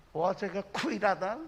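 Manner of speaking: ordinary talk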